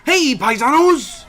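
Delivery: slow, loud hearty paisanos call; Lou Albano's Mario. Retold or imitated. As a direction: boom it out like the cartoon Mario. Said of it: "you have Lou Albano, and he's like".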